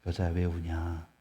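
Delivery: lightly voiced angel asking kindly; gentle angel. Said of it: "이 음성을 생각해 보세요". (조금 느리고 낮게, 부드러운 천사 목소리)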